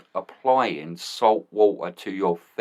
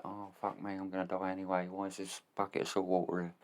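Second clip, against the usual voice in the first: quiet; 3 semitones lower, 15 dB quieter than usual. Retold or imitated. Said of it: "You're laying there like".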